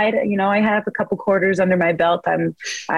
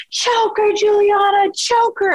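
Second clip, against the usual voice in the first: mom yelling; slower than usual. Retold or imitated. Mom in the nosebleeds cheering on her daughter, high yell. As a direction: holler it down from the nosebleeds. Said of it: "my mom's like".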